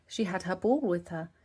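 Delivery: plain reading voice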